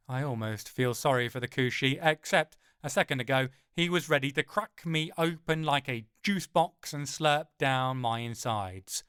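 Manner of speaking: ordinary delivery, nothing marked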